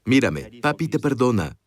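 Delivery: low, gentle fatherly forgiveness; a father holding his son's eyes; tender father to son. Shown as a demonstration. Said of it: "Vean a sus hijos a los ojos".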